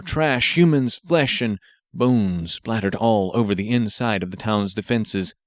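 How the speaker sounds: ordinary delivery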